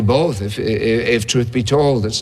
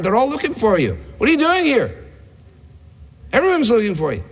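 much higher, urgent and insistent, as if scolding; disciples' pressing reproach; 8 semitones higher than usual. Retold or imitated. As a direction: hurry him back, half accusing. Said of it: "You say it with a rising inflection".

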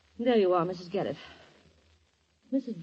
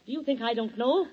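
usual speaking voice

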